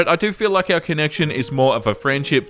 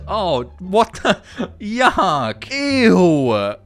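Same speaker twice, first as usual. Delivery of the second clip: slow shocked "what the", laughing; disgusted surprise; 3 semitones higher, much slower than usual. Real, not acted. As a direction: caught off guard, then yuck. Shocked reaction to post.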